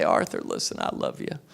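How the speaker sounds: lightly voiced Bill Clinton, low and soft; a hint of Clinton, low and hushed. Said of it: "I'm talking like Clinton now".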